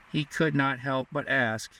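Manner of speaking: plain reading voice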